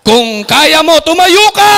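loud, high command to stand up; rallying call. Real, not acted. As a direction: rouse the crowd to its feet. Calling the audience to stand.

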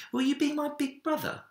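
put-on high, hopeful child's question; hopeful little boy. A narrator's reading. Minik asks the bear to be his brother, high and hopeful. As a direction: ask it in a small boy's high voice. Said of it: "he said".

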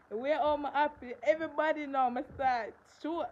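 high, loud joyful telling; overjoyed. Real, not acted. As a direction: let the joy spill over.

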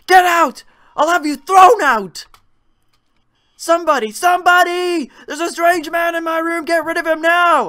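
screaming for someone to come; old woman shrieks for help; panicked screaming. Acted. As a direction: yell in terror at the intruder.

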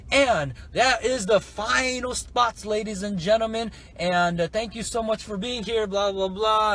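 high, drawn-out sign-off voice; mock grand host farewell; webinar host. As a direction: imitate an announcer wrapping up the show. Imitates host closing the webinar.

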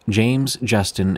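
plain reading voice

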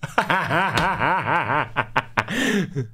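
laughing. Real, not acted. Streamer laughs at joke.

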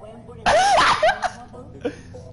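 high, airy laughing fit; breathless squeal of laughter. Real, not acted. Reacting to friend's deep voice.

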